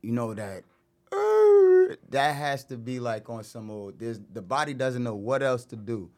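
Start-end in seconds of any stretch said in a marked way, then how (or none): 1.1-2.0 a sudden high strained cry; sob-like cry. Retold or imitated. Recalling sorrow, a high sobbing cry. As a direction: let out one high broken wail.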